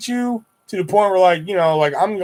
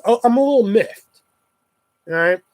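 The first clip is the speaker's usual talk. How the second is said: normal talking voice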